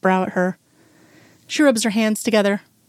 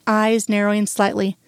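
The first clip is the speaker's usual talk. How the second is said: plain reading voice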